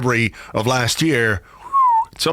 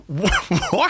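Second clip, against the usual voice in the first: high, stammered mock disbelief with a laugh; exaggerated baffled double take; acted bafflement; 6 semitones higher than usual. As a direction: sputter it incredulously, voice jumping up. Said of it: "And you're like".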